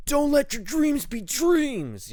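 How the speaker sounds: high, loud, slow motivational shout; Shia LaBeouf. Retold or imitated. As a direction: yell it like the famous pep-talk meme. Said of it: "Shia LaBeouf?".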